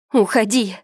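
high piercing shriek. A narrator's reading. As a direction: shriek at him to leave. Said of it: "снова взвизгнула она".

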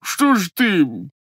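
slow, high, hurt and sulky; high plaintive disguised voice; pained, timid voice. Acted. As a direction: whimper it out, stung and scared. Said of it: "таким голосом, в котором изображалась и боль, и досада, и робость".

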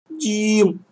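put-on high plaintive child whine; whining. A narrator's reading. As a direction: whine his name pitifully. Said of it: "жалобно заныл Лёшка". (жалобное нытьё)

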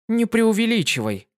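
высокий бойкий девичий голос; звонко и живо, как юная пегаска; бойкая пегаска. Acted. Дитси польщена. (Дитси рассказывает другу, высоко и бойко)

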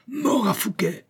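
the king roaring for Fouquet's death. A narrator's reading. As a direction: howl it like a madman past words. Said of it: "hurlait-il".

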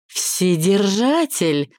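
high, drawn-out excited cry; delighted exclamation. A narrator's reading. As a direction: exclaim it slowly in thrilled amazement. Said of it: "воскликнула она и захлопала в ладоши".

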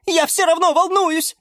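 very high, anxious little voice; frightened squeak. A narrator's reading. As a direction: squeak with worry. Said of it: "испуганно пискнула фиалка Наталка". (тревожно пискнуть тоненьким голоском)

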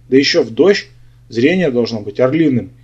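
usual speaking voice

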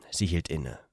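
usual speaking voice

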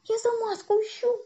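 put-on high-pitched child's whine; plaintive squeal. A narrator's reading. Boy explains his search to man, squealing. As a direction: whine up from below. Boy answers from under seat.

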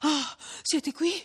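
high, slow, laboured gasp of words; out of breath. A narrator's reading. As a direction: drag the words out with effort, winded. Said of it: "cavando fuori la parola a stento".

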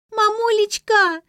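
very high, loud, drawn-out howl; child's wail. A narrator's reading. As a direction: scream for mummy, stretching it out. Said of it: "завопила Лера".

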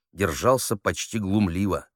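usual speaking voice

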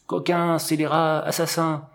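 high, drawn-out calling voice; high-pitched mock shout through the branches; crying out insults. A narrator's reading. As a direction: hurl each insult from afar, high and sustained. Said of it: "je lui ai crié à travers tes branches".

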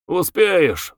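usual speaking voice

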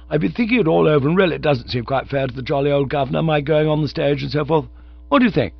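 high, lively upper-class twit; breezy young chap. A narrator's reading. Cyril explaining himself to his friend, high and breezy. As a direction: prattle on up high.

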